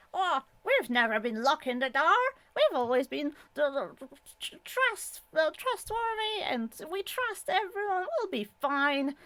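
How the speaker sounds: high, singsong mock of a carefree American; mocking naive homeowner. Vlogger mocking Americans who never lock doors, high mock voice.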